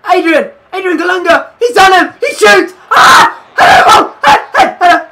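high, urgent yells building to a shriek; frantic excited shouting. Real, not acted. Gamer urges Adrian on as he shoots, frantic excited shouting. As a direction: shout the attack home, voice shooting up. Calling the attack as it happens.

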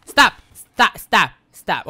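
loud, sharp repeated cries; panicked command. Real, not acted. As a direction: shout it in short, alarmed bursts. Pleading with game threat.

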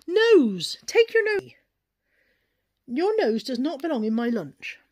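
loud, high telling-off of a nosy pet; scolding the pet. Real, not acted. Owner telling her pet off for sniffing her lunch, loud scolding. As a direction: shoo the nose away, sharp and loud.

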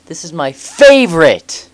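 high, very loud burst of excitement; yelling. Acted. Game character exclaiming over a favorite spot, yelling. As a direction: yell it out at a high pitch. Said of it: "didn't have to yell at me".

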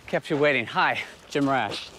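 usual speaking voice

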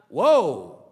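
loud, falling whoa; big drawn-out exclamation, sliding down; stunned exclamation. Real, not acted. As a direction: react to a shocking blow. Preacher reacts to the rebuke.

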